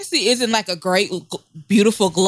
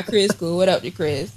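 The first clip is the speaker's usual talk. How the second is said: normal talking voice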